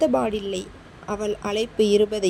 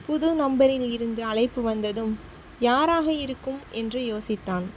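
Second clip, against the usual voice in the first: ordinary delivery, nothing marked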